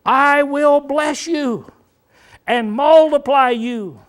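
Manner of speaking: high, loud, drawn-out proclamation. Shown as a demonstration. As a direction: declare it slowly and forcefully. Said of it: "We'd raise our voice".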